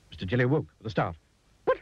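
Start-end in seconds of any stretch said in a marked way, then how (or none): 1.6-1.8 very high-pitched frightened squeal; startled awake and panicking; panicked cry. A narrator's reading. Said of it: "he cried".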